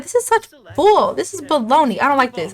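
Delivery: high, indignant mock tantrum; teen outburst. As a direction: vent like a grounded teenager. Grounded teen storms back at dad.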